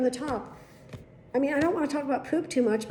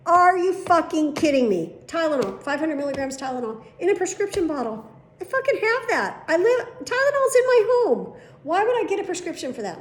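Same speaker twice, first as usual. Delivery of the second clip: loud, high, exasperated rant; real outrage; 7 semitones higher, 5 dB louder than usual. Real, not acted. Patient ranting about a Tylenol prescription, loud and high. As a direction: blow up at the absurdity, pitch climbing.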